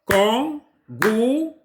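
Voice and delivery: slow, separated syllables in "Kangourou"; teacher sounding out "Kangourou" in three slow beats. Shown as a demonstration. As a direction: break "Kangourou" into kan, gou, rou with pauses. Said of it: "Essayez de décomposer ce mot".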